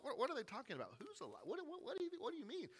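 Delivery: high, soft, baffled stammer; confused disbelief. Retold or imitated. Disciples hearing the news, baffled and stammering. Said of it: "Peter and the guys are all like".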